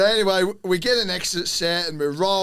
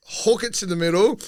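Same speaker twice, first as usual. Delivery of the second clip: normal talking voice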